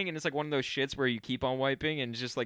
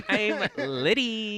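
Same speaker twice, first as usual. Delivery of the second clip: high, loud slurred party voice; kłamany pijacki krzyk, wysoko i głośno; mock drunk voice; 7 semitones higher, 4 dB louder, much slower than usual.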